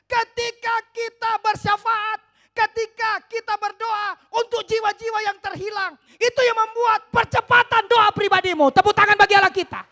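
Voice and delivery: loud, high fiery preaching climax; preaching at full force. Acted. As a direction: build to a shouting sermon peak.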